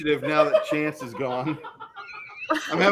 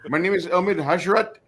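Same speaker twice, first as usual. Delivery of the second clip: light put-on Indian accent, teasing; lightly voiced joke Indian introduction; a hint of a put-on Indian accent; mock Indian accent. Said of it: "do those accents so well".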